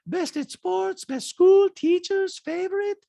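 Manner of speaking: put-on very high-pitched accented caricature; squeaky falsetto mimicry of a reality star; Diana impression. Host mimics reality star in recap, high-pitched caricature. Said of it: "Diana says".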